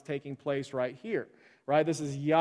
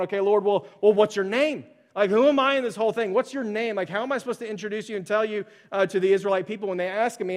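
louder, higher, pleading questions; Moses asking God; 5 semitones higher, 6 dB louder than usual. Retold or imitated. Moses at the burning bush begs God for a name, pleading. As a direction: press God for his name, quickening. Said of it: "Moses is sitting there going".

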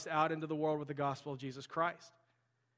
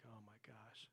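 hushed dread; 5 semitones lower, 23 dB quieter than usual. Retold or imitated. Dread in dark cave retold.

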